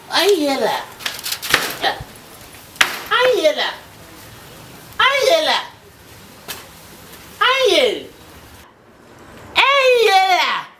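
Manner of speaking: from normal to a high laughing shout; building exclamation. Acted. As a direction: climb with each repeat. Repeating phrase, rising each time.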